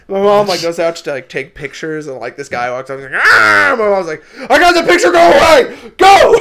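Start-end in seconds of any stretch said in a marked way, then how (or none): 3.2-3.7 high, loud monster snarl; loud mock zombie scream; zombie roar at mom. Zombie actor lunges at mom. 4.5-6.3 high, loud shrieking get-away; mom's panicked high yell; mom shooing him off. Retold or imitated. Mom shoos away the zombie.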